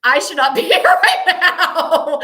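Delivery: high, loud wail broken by laughter; comic inner panic. Retold or imitated. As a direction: wail your regret, half laughing. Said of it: "And the inside, I'm like".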